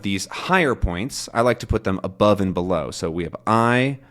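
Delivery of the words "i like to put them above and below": ordinary delivery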